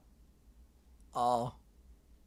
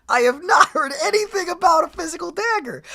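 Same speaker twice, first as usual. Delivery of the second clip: much higher, indignant insistence; 9 semitones higher, 3 dB louder than usual. Real, not acted. To the viewers, indignant and insistent. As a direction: insist she never heard it.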